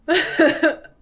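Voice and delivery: short, high burst of laughter; loud laugh. Real, not acted. Retelling the awkward AI answer, loud laugh.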